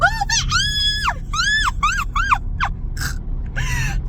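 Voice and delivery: long, very high squeal breaking into laughter; scream-laugh in panic; panicked shrieking laugh. Real, not acted. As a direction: hysterical high cackle of fright. Lizard moves, she shrieks.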